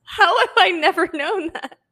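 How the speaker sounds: amused disbelief, laughing. Real, not acted. Host learning the cohost's dad's name, laughing.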